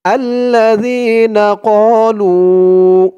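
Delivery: Quran recitation. Shown as a demonstration. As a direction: draw out every vowel like tajweed. Recites the verse.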